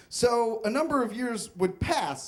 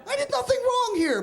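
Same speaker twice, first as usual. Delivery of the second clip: indignant shout. Retold or imitated. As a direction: shout back in outraged disbelief. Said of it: "I'm like".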